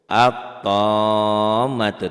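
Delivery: At-tammatu chanted slowly and loudly, lam dropped into a doubled t; recited Arabic example. Shown as a demonstration. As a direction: sing At-tammatu long and drawn out, Quran style. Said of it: "Ini ada contoh-contoh alif lam samsiah". (At-tammatu dilantunkan pelan, lam melebur jadi tt)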